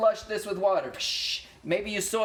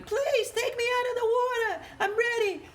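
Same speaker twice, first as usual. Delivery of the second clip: squeaky high voice of the spaghetti begging; talking spaghetti. Acted. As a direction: play the pasta pleading to be taken out, very high. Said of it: "It's telling you".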